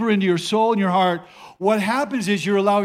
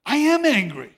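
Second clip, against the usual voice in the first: higher-pitched retold realization, stressing "am"; dawning realization; 5 semitones higher than usual. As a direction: act out it suddenly dawning on you. Acts out his sudden realization.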